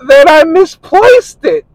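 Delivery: high, slow whiny mock of the girlfriend; mocking his girlfriend's high, slow protest. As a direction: mimic her, high and drawn out. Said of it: "And she's like".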